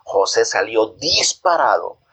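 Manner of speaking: ordinary delivery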